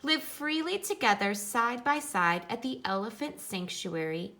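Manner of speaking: picture-book reading voice; reading to children. Acted. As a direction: read it out brightly for the class. Reads picture book aloud to class.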